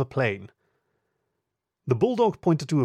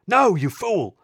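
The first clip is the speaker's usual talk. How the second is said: panicked shout, higher and louder; 8 semitones higher, 6 dB louder, slower than usual. Acted. Inspector yelling at constable, alarmed shout. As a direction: bark it at the constable. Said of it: "the bulldog shouted".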